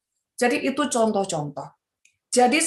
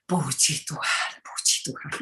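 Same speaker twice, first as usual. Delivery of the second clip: soft pious murmur; 7 semitones lower, 9 dB quieter than usual. Retold or imitated. Soft pious reply when recognised.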